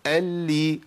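"ال، لي" joined on a held L ending in i; doubled lam, kasra. Shown as a demonstration. Teacher runs through the cases, doubled L with kasra. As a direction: sustain the L in "ال، لي", release into i. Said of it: "المشدده".

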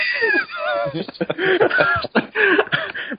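high wheezing laugh; howling laugh. Real, not acted. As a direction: cackle helplessly. Howling at own story.

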